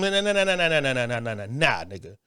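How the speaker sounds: long run of nahs sliding steadily down in pitch; rapid-fire denial that sinks low and quiet; dismissive cut-off. Real, not acted. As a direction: drown out the objection, falling and fading to the end. Cutting off imagined objectors with denial.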